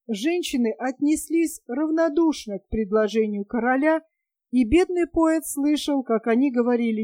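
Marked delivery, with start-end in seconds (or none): none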